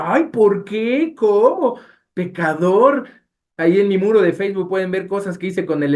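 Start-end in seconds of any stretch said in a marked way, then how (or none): 0.1-3.1 mock shocked churchgoers. People scolding his anger.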